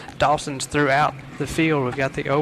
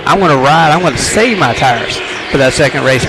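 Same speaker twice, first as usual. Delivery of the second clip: excited race call; 9 dB louder than usual. Real, not acted. Live race call, green flag.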